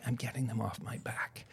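defiant whisper under the breath. Retold or imitated. Going into treatment, he whispers his defiant plan to himself. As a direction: hiss it like a secret vow.

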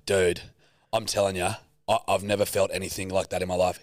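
usual speaking voice

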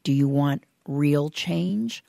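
ordinary talk

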